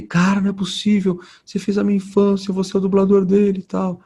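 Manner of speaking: high, amazed rush of recognition; excited fan. Retold or imitated. Retelling a fan who recognized him at the restaurant, thrilled. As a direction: gush like a grown fan meeting his childhood hero.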